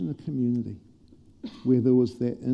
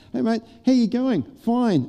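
high, bright throwaway greeting, not listening; breezy autopilot greeting; 10 semitones higher, 5 dB louder than usual. Shown as a demonstration. Mocking the empty greeting people swap and walk away, high and breezy. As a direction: toss it off cheerily without meaning it. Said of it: "that great statement where you".

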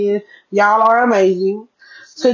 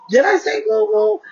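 surprised self-catch; 12 semitones higher than usual. Real, not acted. Catching her own slip.

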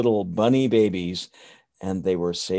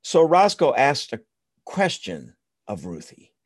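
ordinary delivery

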